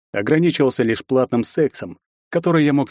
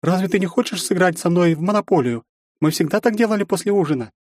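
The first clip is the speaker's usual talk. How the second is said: put-on high, friendly girlish voice; cheerful younger sister; 9 semitones higher than usual. A narrator's reading.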